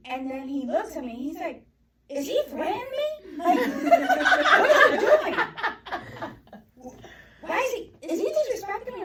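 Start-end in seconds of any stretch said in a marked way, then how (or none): 2.1-9.0 very high, loud mock outrage breaking into laughter; incredulous indignation. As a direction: act out an affronted husband, voice shooting up. Husband reacts to son's threat, retold.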